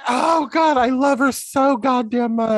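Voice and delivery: high, loud adoring gush. Real, not acted. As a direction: rave about her. Gushing about actress.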